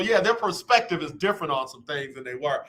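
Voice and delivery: ordinary delivery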